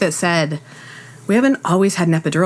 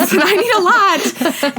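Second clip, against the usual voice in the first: pitched up, mock begging for drugs; high pleading; 10 semitones higher than usual. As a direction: beg for it high and comic. Pleading with the doctor.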